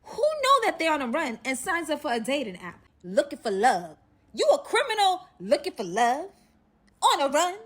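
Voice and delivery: loud, high scolding disbelief; exasperated, punchy tirade; incredulous rant. Acted. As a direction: call out the absurdity at full voice. Ranting at the criminal's dating choice.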